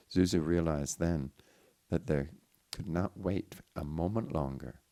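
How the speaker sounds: ordinary delivery, nothing marked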